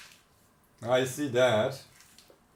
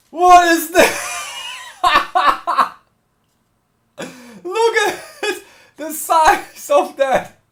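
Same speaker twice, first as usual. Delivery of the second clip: laughing excitement; 12 semitones higher, 8 dB louder than usual. Real, not acted. Huge sprinkles surprise.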